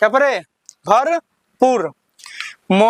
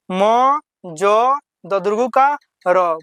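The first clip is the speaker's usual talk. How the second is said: usual speaking voice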